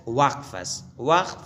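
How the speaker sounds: ordinary delivery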